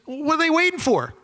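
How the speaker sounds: high, exasperated questions; a pilot fed up the Army hasn't moved, high and incredulous. Retold or imitated. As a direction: demand it in disbelief. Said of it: "He goes".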